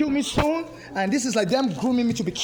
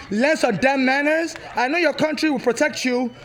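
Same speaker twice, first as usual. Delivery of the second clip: furious scolding; 2 semitones higher than usual. Real, not acted. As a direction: lecture him on manners, word by word. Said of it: "I'm angry".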